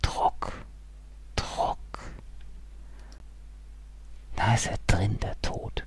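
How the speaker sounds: breathy unvoiced whisper; whispered pressure of death. A narrator's reading. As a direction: whisper the dread.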